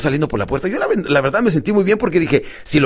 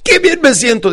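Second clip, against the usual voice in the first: high, loud exclamation; enthusiastic; 10 semitones higher, 5 dB louder than usual. Shown as a demonstration. Coach telling client what to say, enthusiastic. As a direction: proclaim it brightly as a model. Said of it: "pero con entusiasmo".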